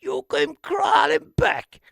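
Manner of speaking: high, slow mock wail; hoodie cry-shout. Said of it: "the hoodie was like".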